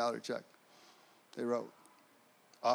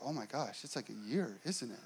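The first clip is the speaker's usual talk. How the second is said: much softer, a private worried hush; hushed dread; 3 semitones lower, 12 dB quieter than usual. Retold or imitated. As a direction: mutter it to yourself in sudden dread. Sudden dread in the car.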